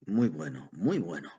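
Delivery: pleased self-congratulation, swooping on the words; self-satisfied. A narrator's reading. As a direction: relish it privately, twice over. Old man proudly congratulates himself in thought.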